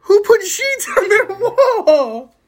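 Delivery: high, drawn-out disbelief; incredulous outburst. Real, not acted. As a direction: stretch it out high in amused disbelief. Baffled by the ad copy.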